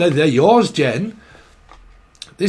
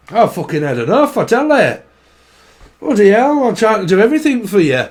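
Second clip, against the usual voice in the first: fed-up northern nagging; exasperated elderly spouse. Acted. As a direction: complain like you do everything round the house. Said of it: "This is an elderly couple, I'm sure".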